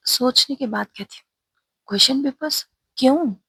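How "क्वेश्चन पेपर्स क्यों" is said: higher and louder, caught off guard; student startled by the question papers, higher and louder. Acted. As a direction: jump at the news of papers.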